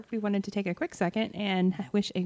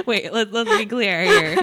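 laughing; 3 semitones higher, 6 dB louder than usual. Real, not acted. Laughing interruption to correct co-host.

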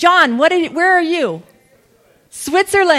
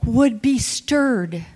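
prayer; 4 semitones lower, 4 dB quieter than usual. Acted. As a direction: pray gently, almost whispering. Slow prayerful preaching, whispered.

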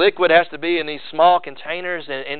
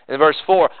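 ordinary talk

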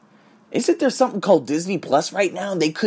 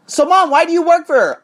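mock whiny question; 13 semitones higher, 7 dB louder than usual. Retold question to his mom.

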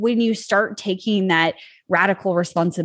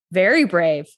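usual speaking voice

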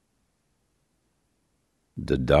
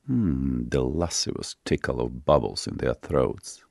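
ordinary delivery, nothing marked

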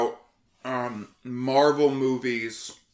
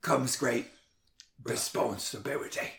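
Michael Caine. Acted. Host telling listeners about young Peter Parker, Michael Caine impression. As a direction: recite solemnly in a put-on British voice, with pauses. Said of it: "that's a pretty good Michael Caine".

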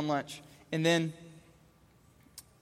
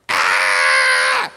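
loud, harsh mock toddler shriek; tantrum scream; 13 semitones lower, 10 dB louder than usual. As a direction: scream like a child in meltdown.

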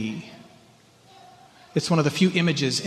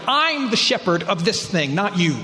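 defiant; 5 semitones higher, 3 dB louder than usual. Retold or imitated. Voicing a stubborn believer.

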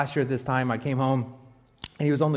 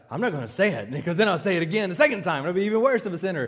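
put-on kid rushing it out; 4 dB louder, faster than usual. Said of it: "he's like".

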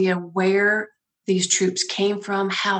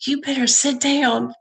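a higher, sing-song Southern "honey" in light imitation; sweet Southern accent; 6 semitones higher than usual. Soldier warns her about the unit size, light Southern accent. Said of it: "with this amazingly sweet Southern accent".

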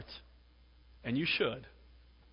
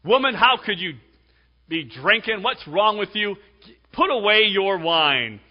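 self-righteous scolding; 6 semitones higher, 9 dB louder than usual. Acted. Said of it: "here's Eli in his self-righteousness".

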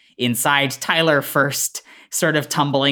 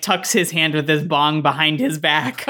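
ordinary delivery

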